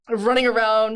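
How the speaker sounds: normal talking voice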